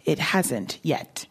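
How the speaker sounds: slow, deliberate repeat of the answer. Retold or imitated. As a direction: spell it out slowly. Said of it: "And my answer was".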